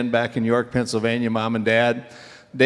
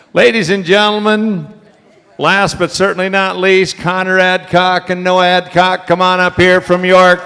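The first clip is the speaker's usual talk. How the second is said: high, loud ringing announcer call; announcer introduction; 8 semitones higher, 10 dB louder than usual. Real, not acted. Auctioneer calls the next graduates to the stage, announcer style. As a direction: boom it out to the whole hall, stretching the last word.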